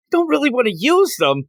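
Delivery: high-pitched mock whining refusal; reluctant protest. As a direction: play a reluctant hero balking at an order. Peter protests Doc Ock's order.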